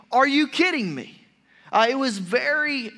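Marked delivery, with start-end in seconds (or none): 0.1-1.2 high, loud, disbelieving "are you kidding me"; incredulous rebuke. Retold or imitated. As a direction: scold them in disbelief. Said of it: "a very strong statement when Paul said this".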